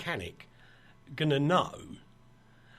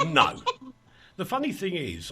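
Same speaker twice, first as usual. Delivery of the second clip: ordinary delivery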